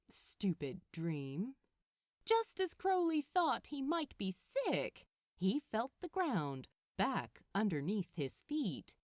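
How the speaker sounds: ordinary delivery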